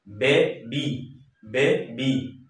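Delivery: বেবি drawn out in two slow beats, বে-বি; teacher slowly sounding out বেবি; syllable by syllable. Shown as a demonstration. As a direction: break বেবি between its syllables. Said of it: "দুইটা উচ্চারণ হচ্ছে একেবারে".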